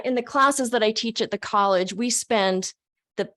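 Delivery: ordinary delivery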